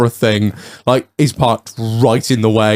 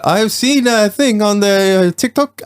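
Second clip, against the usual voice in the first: slow, high mock Swedish accent; halting foreign English, pitched well up; imitated Swedish driver; 8 semitones higher, slower than usual. Locked-out driver proposing a fix, slow Swedish-accented imitation. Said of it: "he's like".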